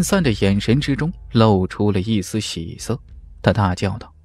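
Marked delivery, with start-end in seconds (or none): none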